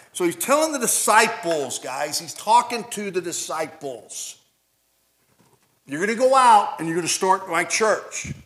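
loud, high, driving sermon delivery; fiery preaching. Acted. Pastor expounding the verse to church, loud fiery preaching. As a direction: hammer the scripture home to the room.

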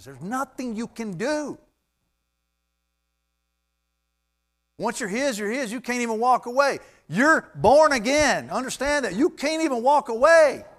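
high, loud preaching crescendo; impassioned pulpit shout; emphatic preaching. Real, not acted. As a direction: raise the voice and drive every phrase home. Preacher driving home security of salvation.

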